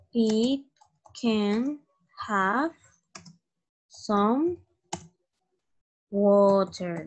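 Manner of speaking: each word set apart with long pauses; slow word by word. Shown as a demonstration. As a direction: dictate it one word at a time. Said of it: "word-by-word dictation to class".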